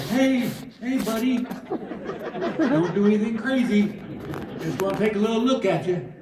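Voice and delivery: high, careful coaxing; high-pitched wary sweet-talk to an owl; coaxing a wild animal. Retold or imitated. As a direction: talk to it like a skittish creature. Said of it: "I'm talking to it".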